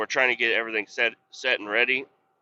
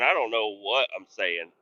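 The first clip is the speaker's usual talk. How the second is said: ordinary delivery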